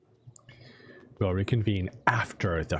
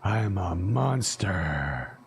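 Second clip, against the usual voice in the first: dejected monster; 2 semitones lower than usual. Acted. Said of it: "Iggy's tone jolted to dejected anger".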